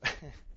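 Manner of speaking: brief breathy chuckle; short laugh. Real, not acted. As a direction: let a quick laugh slip. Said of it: "sorry, I find that humorous".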